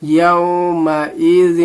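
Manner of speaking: "يَوْمَئِذٍ" slow, the final n hidden in a held hum; ikhfa recitation. Shown as a demonstration. Teacher showing ikhfa, slowly chanted "يَوْمَئِذٍ" with held nasal ending. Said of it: "یہاں پہ اخفا ہوگا".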